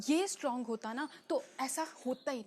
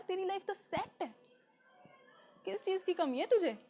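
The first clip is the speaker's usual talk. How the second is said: higher, swooping, dismissive disbelief; mocking incredulity; 6 semitones higher than usual. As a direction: play the friend who just doesn't get it. Acting out dismissive friend.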